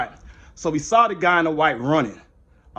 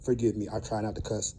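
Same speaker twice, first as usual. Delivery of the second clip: low, soft, contrite muttering; calm yourself down after an outburst; quietly asking forgiveness with a sigh; subdued apology; 8 semitones lower, 5 dB quieter than usual. Real, not acted. Composing himself after an angry rant.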